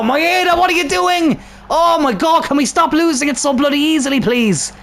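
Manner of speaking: high, strained yell at his own player; exasperated high shout at a careless teammate; frustrated yell. Real, not acted. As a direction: scream it out in frustration until the throat hurts. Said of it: "that really hurt my throat".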